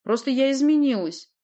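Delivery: high, loud explanation; stubborn insistence. A narrator's reading. Sorceress explains herself to doubting uncle, stubborn. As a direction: insist on it firmly. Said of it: "упрямо повторила колдунья".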